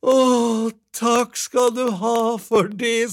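high, loud, slow gushing thanks; effusive thanks. A narrator's reading. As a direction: draw out the thanks in the wife's high voice. Wife thanks husband effusively.

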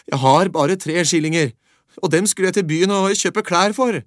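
put-on boy telling the beggar about his coins, high and loud. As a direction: protest brightly like a young lad.

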